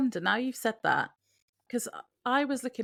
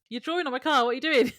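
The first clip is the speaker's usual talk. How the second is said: higher, louder mock outrage; indignant reaction; 7 semitones higher, 5 dB louder, faster than usual. Dean reacts to Sam drawing on his car, indignant. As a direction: act out an affronted car owner's protest. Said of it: "I liked Dean's reaction".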